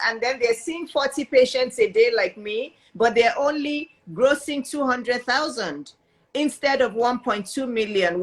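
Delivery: usual speaking voice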